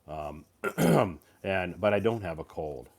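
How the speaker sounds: ordinary delivery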